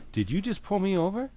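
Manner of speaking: high, disbelieving challenge to a cop; retold shock at being pulled over; incredulous.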